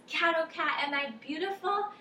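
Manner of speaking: lightly voiced dog fishing for a compliment; dog begging for praise.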